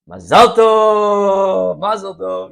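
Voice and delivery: loud, drawn-out festive cheer; crowd yelling mazal tov. Retold or imitated. Guests cheering as the glass breaks, loud joyful shout. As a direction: shout it like the whole wedding hall. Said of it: "everyone yells".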